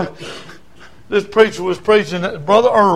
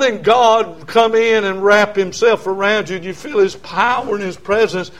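normal talking voice

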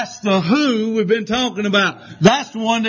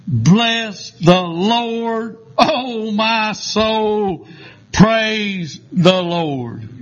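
reverent scripture reading; much slower than usual. Acted. Preacher reading the closing praise to the church, very slow. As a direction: stretch each word out in reverence.

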